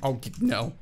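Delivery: real cracked-voice groan. Real, not acted. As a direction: voice breaking on "no". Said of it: "Did you like that voice crack?".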